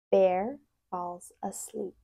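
ordinary delivery

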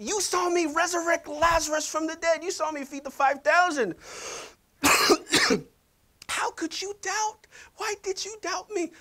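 hammed-up Jesus scolding Peter; mock accusing Jesus. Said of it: "he looked at us and said".

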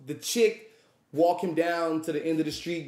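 ordinary delivery

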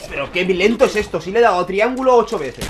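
loud, exasperated complaint; angry rant. Real, not acted. Gamer complaining about slow game.

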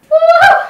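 loud high cheering whoop; excited whoop. Real, not acted. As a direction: whoop it out with a laugh. Cheering during workout.

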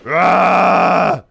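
loud, drawn-out yell; battle yell. Real, not acted. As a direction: let out a loud "aah" at the zombie. Scream at the zombies.

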